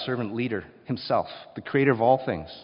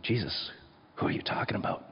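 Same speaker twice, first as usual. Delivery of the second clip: hushed, breathy whisper, softer; quiet whisper; 2 semitones lower, 6 dB quieter than usual. Retold or imitated. John whispering to Jesus at the table, softly. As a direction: lean in and whisper the question. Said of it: "in a quiet whisper".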